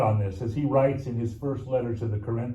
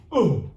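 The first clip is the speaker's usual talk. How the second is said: grunt of effort; 3 semitones higher, 7 dB louder than usual. Retold or imitated. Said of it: "the companion would go".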